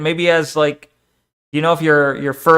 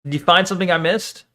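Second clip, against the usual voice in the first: ordinary talk, nothing marked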